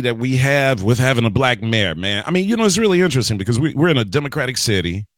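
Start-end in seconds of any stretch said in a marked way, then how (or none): none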